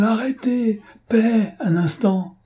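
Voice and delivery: high, slow begging to the thunder; frantic pleading. A narrator's reading. Fotheringay begs the thunder for a moment of peace, frantic plea. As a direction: plead with the storm to stop, slowly. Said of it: "dit-il au tonnerre et aux éclairs".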